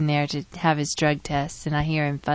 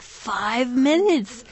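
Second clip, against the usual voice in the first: ordinary talk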